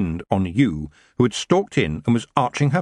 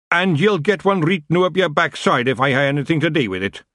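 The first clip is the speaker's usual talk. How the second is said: put-on growling Scottish accent; 6 semitones higher than usual. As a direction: growl it in broad Scots. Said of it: "Big Yan growled".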